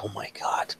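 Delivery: soft whispered dread; horrified whisper. Acted. Wife whispering in horror at the news. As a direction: barely breathe the words. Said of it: "she's like".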